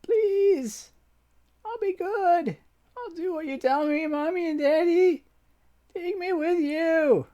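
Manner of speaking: put-on a desperate girl begging to be taken along; high pleading child voice; tearful begging child. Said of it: "Her cries mingle with tears".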